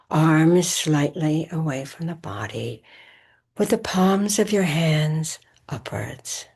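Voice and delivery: measured meditation voice with long pauses; guided meditation. Acted. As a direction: guide listeners into rest, phrase by phrase, leaving space between. Leads a recorded Yoga Nidra session.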